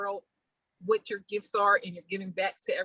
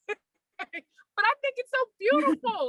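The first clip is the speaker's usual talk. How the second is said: laughing delight; 12 semitones higher, much slower than usual. Real, not acted. Host gushing over guest's name.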